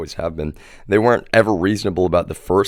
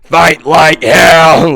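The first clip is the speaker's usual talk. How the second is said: much higher, louder, slow drawn-out stage shout; mock Trump rally yell; 10 semitones higher, 12 dB louder, much slower than usual. Trump on stage rousing the crowd, hammed-up loud yell. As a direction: bellow it like a rally speaker, slow. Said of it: "He goes up on stage and he's like".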